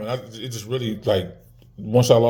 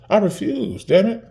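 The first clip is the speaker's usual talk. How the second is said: fierce refusal; 10 semitones higher, slower than usual. Real, not acted. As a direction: pitch leaps much higher in defiant protest. Vows to put daughter first.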